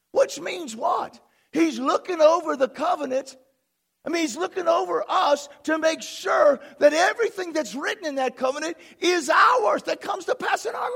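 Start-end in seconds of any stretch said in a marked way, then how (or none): 1.5-10.9 raised, rising sermon voice; high-pitched pulpit insistence; emphatic preaching. Real, not acted. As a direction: drive the promise home to the congregation. Preaches at full force.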